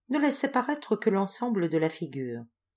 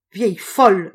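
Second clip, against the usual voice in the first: loud, furious yell; howled insult; 2 semitones higher, 11 dB louder than usual. A narrator's reading. Enraged old woman to her rival, howling. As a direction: howl it out in rage, far louder. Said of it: "hurla-t-elle en se redressant".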